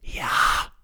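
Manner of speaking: barely-there unvoiced whisper; voiceless whisper. A narrator's reading. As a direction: whisper it as soundlessly as you can. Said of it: "so stimmlos wie nur möglich zu flüstern". (stimmlos geflüstert)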